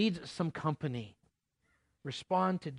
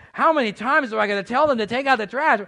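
much louder, higher, fed-up parent yell; exasperated parent; 8 semitones higher, 12 dB louder, faster than usual. Retold or imitated. Pastor playing a parent fed up with the kids, loud. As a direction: let the frustration blast out. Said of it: "if you're a parent".

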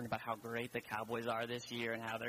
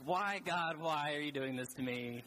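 louder, higher plea to heaven; hammed-up wail of protest, easing off; mock anguish; 3 semitones higher, 3 dB louder, slower than usual. Struggling Bible reader crying out to God, mock anguish.

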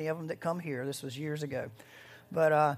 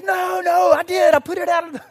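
much higher, much louder blurt; panicked confession; 13 semitones higher, 15 dB louder than usual. Retold or imitated. Driver frantically confessing to dodge the dumping fine, high and loud. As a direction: scramble to take it back. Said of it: "the guy goes".

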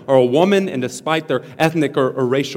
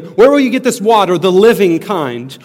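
"living" marked out in a raised, loud question; stress on living; 4 semitones higher, 6 dB louder than usual. Shown as a demonstration. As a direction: ask it, then underline "living". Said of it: "Remember, emphasis on the living".

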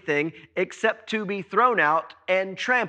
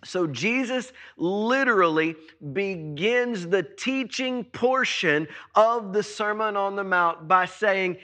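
usual speaking voice

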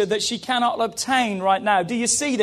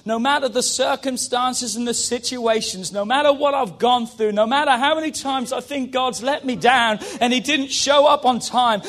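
ordinary talk

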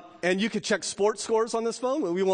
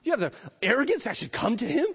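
a taunting inner voice, pitched up and hushed; accuser's voice; 7 semitones higher, faster than usual. Acted. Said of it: "You ever hear that voice? ... your accuser's voice".

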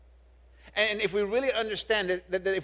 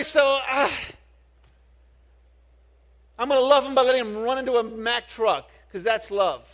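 passionate preaching; 6 semitones higher, 6 dB louder than usual. Acted. Preaching build, sarcastic parent scenario.

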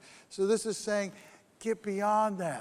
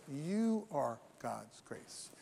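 much softer, slow, lower; hushed emphasis; 6 semitones lower, 8 dB quieter, much slower than usual. Acted. Preacher telling listeners they are grace, soft and slow. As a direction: let it land softly and slowly. Key line of sermon.

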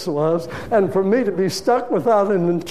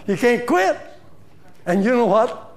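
usual speaking voice

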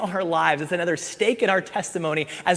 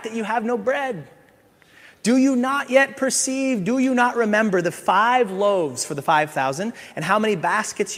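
higher, louder scripture rebuke; preached rebuke; 6 semitones higher, 4 dB louder than usual. Acted. Jesus rebuking his disciples, read out as forceful preaching. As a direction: thunder Jesus' words at the crowd.

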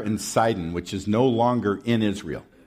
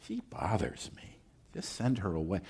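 a much softer, offhand brush-off; mock dismissive aside; 2 semitones lower, 12 dB quieter than usual. Preacher voices a dismissive disciple, soft brush-off. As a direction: toss it away quietly, uninterested. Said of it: "the other said".

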